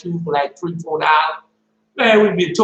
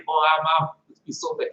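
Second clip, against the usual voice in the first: normal talking voice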